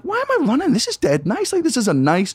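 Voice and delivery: high-pitched blissful puzzlement; acted dazed wonder. Acting out blissed-out distracted self.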